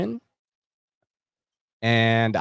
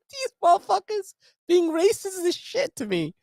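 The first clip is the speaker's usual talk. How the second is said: mock outraged thought. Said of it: "I'm like".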